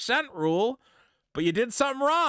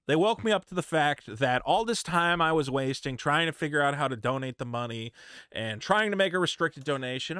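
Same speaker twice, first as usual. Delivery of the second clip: usual speaking voice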